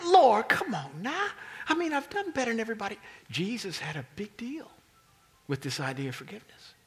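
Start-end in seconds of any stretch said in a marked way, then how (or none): 0.1-3.0 high, loud complaining whine; preacher playing Peter's high whiny protest; mock whine. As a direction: plead like a wronged student. Peter whining at Jesus.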